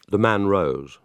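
ordinary delivery

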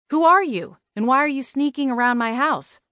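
high-pitched, insistent questioning; confronting. A narrator's reading. She confronts the intruder.

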